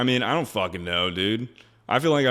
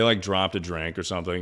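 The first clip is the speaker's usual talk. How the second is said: ordinary delivery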